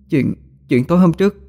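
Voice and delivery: high, rushed, pleading explanation; desperate plea. A narrator's reading. Frightened wife pleads her innocence to her husband. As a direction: explain in a rush, begging to be believed.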